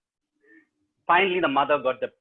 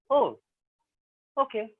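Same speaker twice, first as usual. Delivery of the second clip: flat no excitement; 6 semitones higher than usual. Retold or imitated. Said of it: "he also says".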